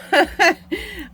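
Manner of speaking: a quick, high, emotional laugh; short high laugh. Real, not acted. Vlogger laughs, touched by her friend's gift, bright laugh. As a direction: let out one bright laugh, moved.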